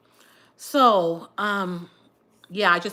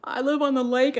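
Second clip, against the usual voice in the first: higher and louder, laughing it out; laughing confession; 7 semitones higher, 5 dB louder than usual. Real, not acted. Confessing to viewers she lives by the lake, laughing. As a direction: admit it through a laugh.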